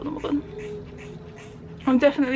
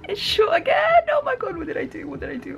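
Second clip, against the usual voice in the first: shrieking jackpot winner; 10 semitones higher than usual. Real, not acted. Cutting own hair too short.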